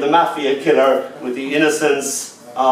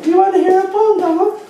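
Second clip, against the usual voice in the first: put-on high little-girl voice; 3 dB louder, faster than usual. Father imitating his little daughter asking to recite, high child voice. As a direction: pipe it up like a small child asking. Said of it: "And she said".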